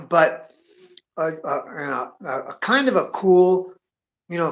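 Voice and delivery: ordinary delivery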